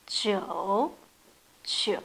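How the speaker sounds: « Jiu » grave qui plonge; « Jiu » descendant puis remontant; jiu troisième ton. Shown as a demonstration. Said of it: "Troisième ton". (jiu au troisième ton)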